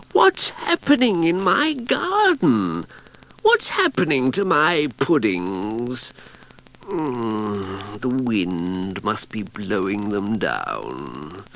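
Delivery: woken-up witch calling into the garden; sleepy Cassandra. Acted. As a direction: call out half-asleep, puzzled. Cassandra wakes, calls out.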